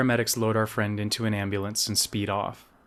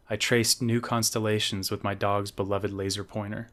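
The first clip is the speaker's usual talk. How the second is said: plain reading voice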